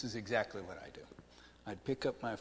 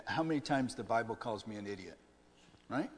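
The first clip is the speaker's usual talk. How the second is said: ordinary talk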